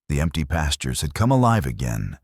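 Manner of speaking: usual speaking voice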